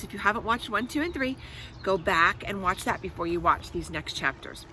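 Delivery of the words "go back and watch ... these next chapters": plain reading voice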